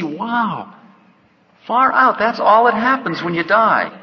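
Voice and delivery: a seeker's awed "far out"; amazed. Retold or imitated. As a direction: marvel like it just dawned on you. Awakening soul marvels at death.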